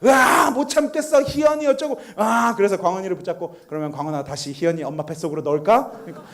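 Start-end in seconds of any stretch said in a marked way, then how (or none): 0.0-2.0 exploding with annoyance. Retold or imitated. Older son fed up with baby brother, imitated annoyed outburst. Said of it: "광원이가 짜증이 폭발한 거예요". 3.7-5.9 coaxing father. Retold or imitated. Father soothing his upset son.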